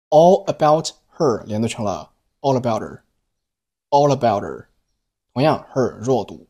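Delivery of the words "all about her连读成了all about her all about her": all about her blended slowly with no h in her; linked all about her. Shown as a demonstration. Teacher demonstrating linking all about her. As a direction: run all about her together smoothly.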